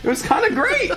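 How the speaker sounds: high laughing disbelief. Retold or imitated. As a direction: laugh through the words in shock. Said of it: "Just like".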